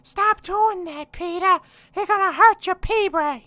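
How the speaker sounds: Lois scolding. Acted. Wife scolds Peter in a shrill high falsetto. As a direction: shriek at Peter like a nagging wife.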